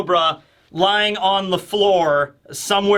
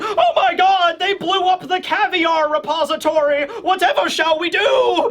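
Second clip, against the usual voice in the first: hammed-up aristocrat in distress; panicking snob; 12 semitones higher than usual. Panicking Tenryubito nobles.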